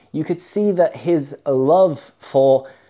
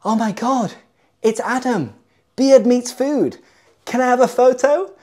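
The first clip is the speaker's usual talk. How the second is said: high, louder starstruck gush; retold excited fan gasp; 8 semitones higher, 3 dB louder than usual. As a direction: gush like meeting a celebrity.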